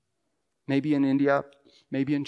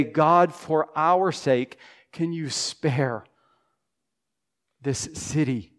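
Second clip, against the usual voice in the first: pleading prayer; 5 dB louder than usual. Pretend. Voices an intercessory prayer.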